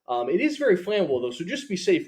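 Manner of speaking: high, loud, breezy pitchman voice; cheery old-timey salesman. Retold or imitated. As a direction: sell danger like good news. Voiced 1950s oil executive.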